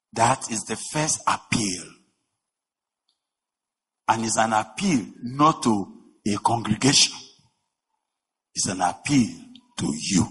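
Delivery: ordinary talk, nothing marked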